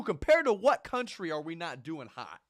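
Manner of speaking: loud, fast indignant challenge. Real, not acted. Ranting at the complaining lady, loud and indignant. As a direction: demand an answer, fired up.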